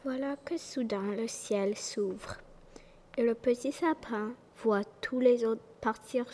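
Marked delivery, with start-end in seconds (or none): none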